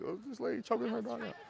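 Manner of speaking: high, soft, quick mock onlooker voice; hushed, high-pitched aside, mimicking bystanders; gossiping onlookers. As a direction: imitate scandalized bystanders in a small high voice. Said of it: "everybody looking around you like".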